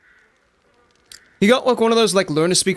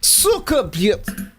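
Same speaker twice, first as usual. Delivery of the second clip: imitating Russian swearing; 3 semitones higher, much slower than usual. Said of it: "I watched Life of Boris".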